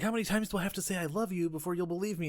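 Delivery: quick, high put-on pleading; mock exasperated plea. Girlfriend begging boyfriend to believe her, hurried plea.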